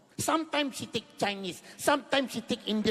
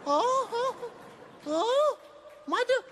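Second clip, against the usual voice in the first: put-on high, whiny hurt child voice; a little boy offended for his mother; mock wounded child; 7 semitones higher than usual. Child upset mother unimportant.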